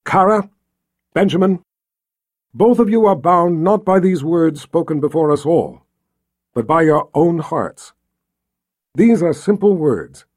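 formal officiant's projection; ceremonial wedding address. A narrator's reading. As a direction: speak solemnly to the couple before a crowd. Richard's speech to the couple.

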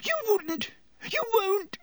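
very high panicked gasp. A narrator's reading. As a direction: gasp it out in shrill disbelief. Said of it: "gasped Pettigrew".

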